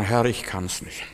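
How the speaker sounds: slow, soft, low pious excuse; hushed mock-pious helplessness; false humility. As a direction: play meek and incapable. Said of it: "das ist falsche Demut".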